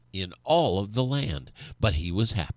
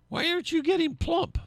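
much higher, strained frustrated question; frustrated farmer; faster than usual. A narrator's reading. Farmer asks his flat turkey why it won't fatten, much higher and frustrated. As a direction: ask the flat turkey in exasperated disbelief.

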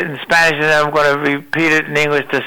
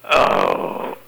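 breathy sigh; 4 dB quieter, much slower than usual. Real, not acted. Sighing at being ninety-six.

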